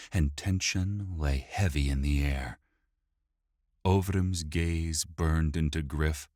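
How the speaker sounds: usual speaking voice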